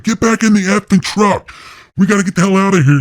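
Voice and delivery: high, frantic shouted order; retold yelling, high and pressing; urgent yell. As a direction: yell at them to get back in. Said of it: "Jeremiah's like".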